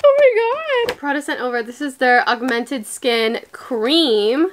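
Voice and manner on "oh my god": high, squealed excitement; thrilled amazement. Real, not acted. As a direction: let it fly up high in real delight. Thrilled opening the gift.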